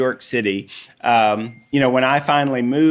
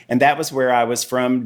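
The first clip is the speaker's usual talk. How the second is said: ordinary talk, nothing marked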